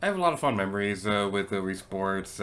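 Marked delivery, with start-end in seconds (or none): none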